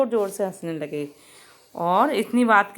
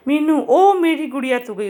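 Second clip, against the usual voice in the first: frightened cry; 4 semitones higher, 5 dB louder than usual. A narrator's reading. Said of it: "उसको डर लगा कि".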